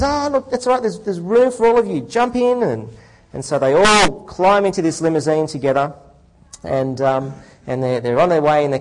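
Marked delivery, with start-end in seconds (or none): 0.0-2.6 high, breezy lawyer; hearty host, much higher and quick. Acted. Lawyer voiced in joke.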